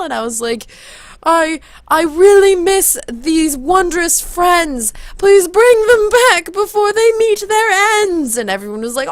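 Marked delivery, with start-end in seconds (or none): 1.2-8.4 high, loud, slow incantation; declaimed spell. Retold or imitated. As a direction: chant a rhyme grandly like a spell. Retells casting a rhymed spell.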